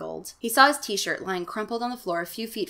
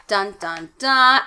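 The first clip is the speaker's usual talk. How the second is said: louder mock cliffhanger "Dun, dun, dun" with a punched last "dun"; dramatic sting; 9 dB louder, much slower than usual. Host closing a chapter with a mock dun dun dun sting. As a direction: boom the final "dun" like a suspense chord. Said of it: "Out of the frying pan".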